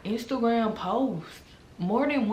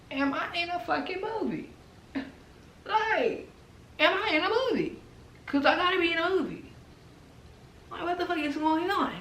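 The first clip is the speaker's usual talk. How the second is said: incredulous; 10 semitones higher than usual. Real, not acted. Disbelief at the drama.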